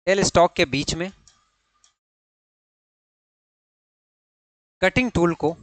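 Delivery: word groups with long pauses between; dictation. Real, not acted. Teacher dictating notes for students to write, pausing after each phrase. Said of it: "यहां पे आप क्या लिखेंगे".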